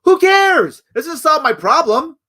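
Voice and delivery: high, loud dismissive retort; fed-up voter. Retold or imitated. Fed-up average American brushing off a libertarian history lesson, high and loud. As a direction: snap back like an annoyed voter who wants answers.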